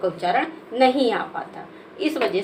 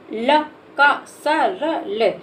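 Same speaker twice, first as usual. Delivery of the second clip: slow syllables; 2 semitones higher, much slower than usual. Shown as a demonstration. Teacher sounding out सरल for a child, very slow. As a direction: drag each syllable out for a child. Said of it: "ला को धीरे से बोलते हैं".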